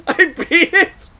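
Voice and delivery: loud, high laughing, slow words breaking into laughter; laughing in disbelief. Real, not acted. Gamer laughs after beating the game by dying, loud real laughter. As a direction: crack up at the absurd win.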